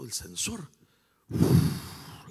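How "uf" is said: breathy voiceless whoosh; whoosh sound. Shown as a demonstration. Preacher showing congregation the sensor door opening, voiceless whoosh. Imitates automatic door sliding open.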